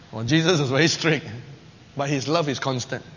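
usual speaking voice

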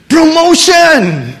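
high, loud, slow proclamation; triumphant announcement. Acted. Preacher proclaiming the promotion to the church. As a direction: draw out the word like a grand reveal.

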